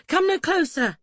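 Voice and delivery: high, loud warning to keep back; alarmed warning. A narrator's reading. Bather waving Duncan away from the tub, high alarmed warning. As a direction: ward someone off, pitched high and urgent.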